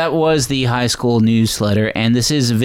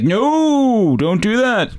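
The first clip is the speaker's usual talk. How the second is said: high, drawn-out pleading "no"; security guard impression; 11 semitones higher, much slower than usual. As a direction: step in as a high-voiced, ineffectual guard. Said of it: "very good impression of the s-, of the security guard".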